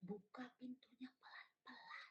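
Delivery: very soft storytime whisper. Shown as a demonstration. Teacher showing a whispering voice for stories, whisper. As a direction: whisper it as if sneaking. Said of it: "suara berbisik".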